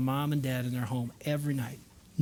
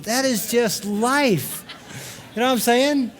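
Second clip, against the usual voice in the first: laughing; 6 semitones higher, 5 dB louder, slower than usual. Real, not acted. Chuckling at his own joke.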